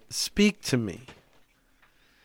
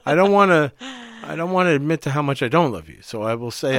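ordinary delivery

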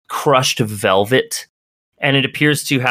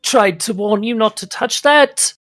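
Agnes warning; 10 semitones higher than usual. Acted. GM voices NPC Agnes warning.

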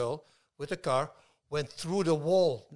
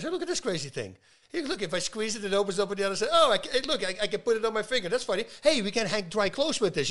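higher-pitched, playfully amazed astronaut; lightly hammed-up wonder, pitched up; acted excited discovery; 6 semitones higher than usual. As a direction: act out delight at a curious find.

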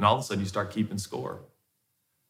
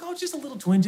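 much higher, lightly voiced dismissive wife; imitating wife; faster than usual. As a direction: brush it off like his wife did. Wife's quote voiced in story.

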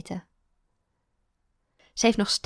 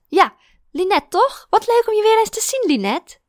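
ja, Linette with a rising high tune; ja, Linette rising, asking for confirmation; questioning delight; 12 semitones higher, 8 dB louder than usual. Acted. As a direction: check the name, high and hopeful. Teacher asks, delighted.

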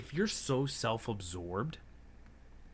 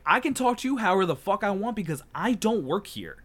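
loud, high angry comeback, retold; re-enacted indignant shouting; losing control; 7 semitones higher, 8 dB louder than usual. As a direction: fire back at full volume. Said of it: "lost control".

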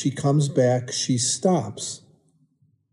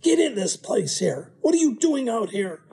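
much higher, mother's voice, calling him in; scolding mom; 10 semitones higher than usual. Retold or imitated. Mother at communion ordering her son back inside, high scolding voice. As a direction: play his mother hauling him in from the deck.

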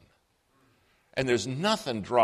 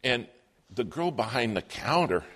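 usual speaking voice

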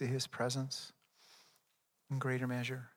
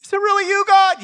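desperate cry for help; 16 dB louder than usual. Acted. Climber dangling from a cliff cries to God, very high panicked shout. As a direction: scream it up to heaven, hanging on for life.

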